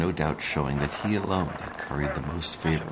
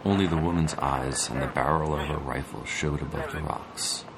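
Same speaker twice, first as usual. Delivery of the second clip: usual speaking voice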